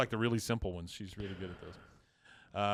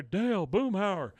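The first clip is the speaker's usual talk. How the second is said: drawn-out, high-pitched mock call; stretched-out retold holler; 7 semitones higher, 4 dB louder than usual. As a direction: call slowly for missing friends. Retold Hank calling out to friends.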